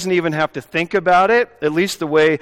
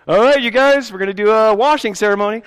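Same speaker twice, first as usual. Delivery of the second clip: bright, raised announcer voice, fast finish; mock announcement; 6 semitones higher, 4 dB louder, faster than usual. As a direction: play the overeager pastor. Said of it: "mock pastor announcing ceremony".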